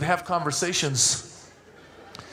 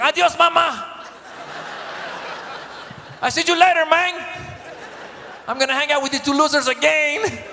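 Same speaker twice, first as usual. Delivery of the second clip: little son's goodbye; 7 semitones higher than usual. Retold or imitated. Dad imitating toddler saying bye to nanny, higher kid voice. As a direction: play a cheeky toddler waving off the nanny. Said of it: "he's like".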